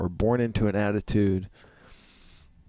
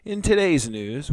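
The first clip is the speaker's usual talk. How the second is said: news anchor; 6 semitones higher than usual. Pretend. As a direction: announce it like a TV anchor. Mock news announcer opening.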